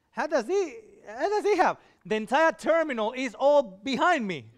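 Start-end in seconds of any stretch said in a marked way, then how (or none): 0.1-1.8 high, puzzled mock question; puzzled onlookers. Voicing the baffled crowd behind him, high and puzzled.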